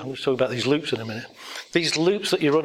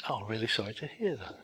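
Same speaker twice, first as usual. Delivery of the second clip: sympathetic; 4 semitones lower, 11 dB quieter, faster than usual. Retold or imitated. The usual sympathetic reply.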